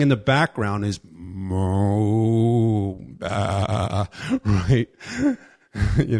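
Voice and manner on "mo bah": low, drawn-out mock baa; preacher sneaking a sheep's baa behind Saul's claim; imitated sheep bleat. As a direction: bleat like a hidden lamb.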